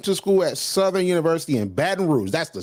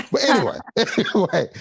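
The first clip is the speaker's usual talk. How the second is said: laughing it off. Real, not acted. Laughs while moving on.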